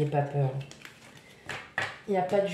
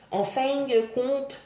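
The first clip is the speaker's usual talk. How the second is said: clumsy Québécois accent, higher and slow; Quebec accent; 6 semitones higher, slower than usual. Shown as a demonstration. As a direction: try a Quebec accent, drawn out. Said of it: "emmêlée entre, euh, le Québec et la France".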